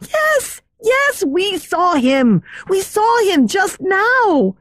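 put-on high, insistent girlish cries; girls crying out. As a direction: protest eagerly like frightened girls. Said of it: "cried the girls".

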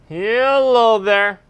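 long stretched hello call; slow drawn-out call. Real, not acted. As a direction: draw the call out slowly. Calling out at a house.

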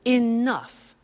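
enough said slowly and clearly, stress on the second syllable; careful teacher's enough; pronunciation demo. Shown as a demonstration. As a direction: model enough for the class. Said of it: "The pronunciation is".

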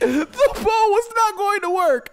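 high, slow teary laughter; sob-laugh through it; laugh-crying. Real, not acted. Player mocking his useless bow, laugh-crying.

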